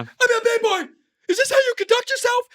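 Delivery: high, loud mocking movie quote; quoted film outburst. Mocking The Other Guys for friend, high shouted quote. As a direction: yell the line in a mocking high voice. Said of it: "The Other Guys".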